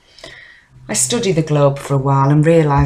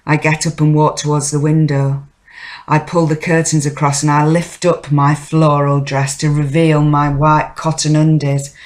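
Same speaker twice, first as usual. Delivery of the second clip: ordinary talk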